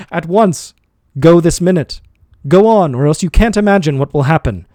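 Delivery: angry threat. A narrator's reading. Master threatening servant, angry orders. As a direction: threaten him hard.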